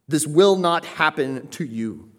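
"will not" slow, high and loud; emphatic refusal. Acted. Peter refusing what was foretold to Christ, emphatic. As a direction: lean hard and slowly on "will not". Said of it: "Peter's being emphatic".

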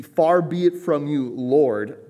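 high, loud, slow appeal; over-the-top invoking. Acted. Peter invoking the Lord against what's coming, emphatic. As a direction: call it out slowly and emphatically. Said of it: "over-the-top invoking the Lord".

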